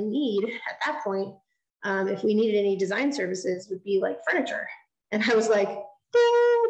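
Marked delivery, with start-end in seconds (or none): 5.7-6.6 high, loud mock bell ding; imitated ding. Realizing the answer to a meeting, bright mock bell ding. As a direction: ring out like a light bulb going on. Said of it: "the light bulb went off".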